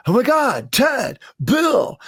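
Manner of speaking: high-pitched surfer dude impression; Bill and Ted. Comparing Mark to an excellent adventure, high dude voice. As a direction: call out like an amazed California dude. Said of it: "Bill and Ted's Excellent Adventure".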